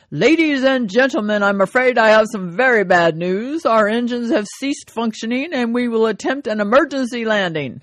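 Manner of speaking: hammed-up captain's PA announcement. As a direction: announce it like a pilot on the intercom. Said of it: "over the public address system, the captain announces".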